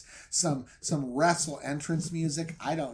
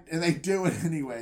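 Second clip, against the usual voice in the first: ordinary talk, nothing marked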